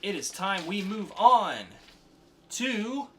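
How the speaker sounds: hyped podcast segment announcer, building up. Acted. Host hypes the audience into the next segment, showman announcer.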